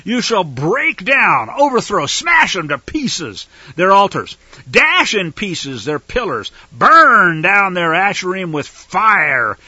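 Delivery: loud, slow, hammering each verb; emphatic. Shown as a demonstration. Preacher reading commands to destroy altars, emphatic and loud. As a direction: declaim it forcefully, stretching the action words. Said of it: "emphatic real action verb".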